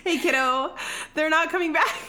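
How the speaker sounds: high, careful sing-song; mock-gentle news-breaking. Roz telling Tom his family left, mock-gentle.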